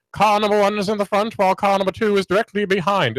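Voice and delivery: high-pitched vintage broadcast voice; old-time race announcer. Retold or imitated. Host parodying early radio narrating a car race, vintage announcer. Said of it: "They all talk like that".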